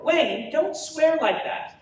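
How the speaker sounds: high, slow scolding through a laugh; mother half-laughing as she scolds. Acted. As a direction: scold him but can't hold the laugh.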